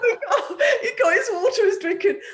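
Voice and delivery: high, loud laughing retelling. Real, not acted. Laughs retelling son asking water.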